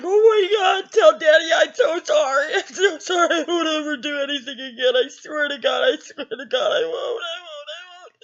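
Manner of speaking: acting out high, tearful panicked begging; flipping out. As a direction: babble apologies in mock sobs, tailing away. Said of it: "I was flipping out".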